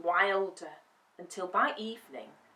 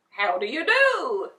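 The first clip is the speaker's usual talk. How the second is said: scarecrow voice; 3 semitones higher, 9 dB louder than usual. Acted. Scarecrow greets Dorothy.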